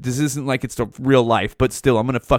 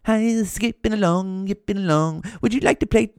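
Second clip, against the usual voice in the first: prance the words out in a high, taunting little voice; mocking sissy voice; 7 semitones higher than usual. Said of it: "you skip along fucking Howdy Doody with a fucking skirt on".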